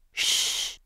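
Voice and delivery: soft, drawn-out shush; hushing shush. A narrator's reading. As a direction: hush her with a finger to the lips. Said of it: "finger to his lips".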